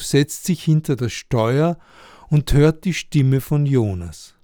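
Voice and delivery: plain reading voice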